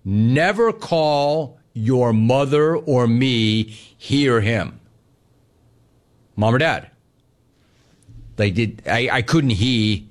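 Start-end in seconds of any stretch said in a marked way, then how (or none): 0.0-4.8 slow, deliberate fatherly rule; a stern father's slow rule; emphatic. Retold or imitated. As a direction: lay down the law word by word. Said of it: "Jay was, like, emphatic".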